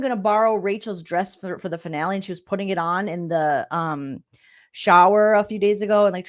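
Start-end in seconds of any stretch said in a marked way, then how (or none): none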